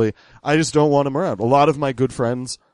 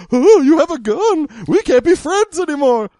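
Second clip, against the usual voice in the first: very high, loud mock horror; hammed-up hysterical falsetto; squeamish liberal, freaked out; 6 dB louder than usual. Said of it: "some squeamish liberal will be freaked out".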